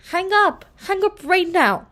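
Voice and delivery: high, loud commands; loud frantic order to hang up; urgent shout. A narrator's reading. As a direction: shout her into hanging up. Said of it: "I shouted".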